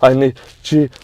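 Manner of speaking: mock panicked gasps into a loud, high squeal; hyperventilating. Starstruck fan out of his hero's sight, mock hyperventilating. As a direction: act out losing it, hammed up and breathless. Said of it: "start minorly hyperventilating".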